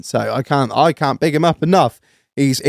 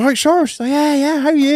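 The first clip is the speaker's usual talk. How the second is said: chirpy greeting; 11 semitones higher, faster than usual. Retold or imitated. Greeting a friend at fireworks.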